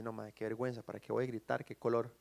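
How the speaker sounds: low, soft, sheepish mumble; mock embarrassment. Preacher mocks crash victim too shy to shout, embarrassed. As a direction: act too bashful to call out.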